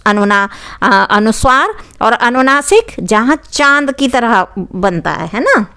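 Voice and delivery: usual speaking voice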